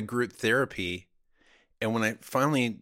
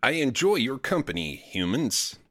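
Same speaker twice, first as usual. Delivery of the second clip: stilted, evenly spaced words; robot posing as human. Pretend. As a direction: recite it like an android being sociable. Continues robot small-talk bit.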